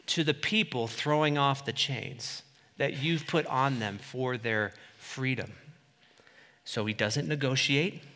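ordinary talk, nothing marked

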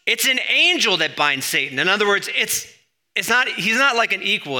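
high, loud and insistent; animated preacher insisting. Real, not acted. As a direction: push it up high and loud to make the point. Pastor emphatic about Satan's rank.

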